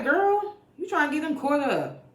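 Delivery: very high mock-alarmed exclamation; high-pitched warning. Recapper warning the pastor's wife, high-pitched mock alarm. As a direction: squeal a scandalised warning at her.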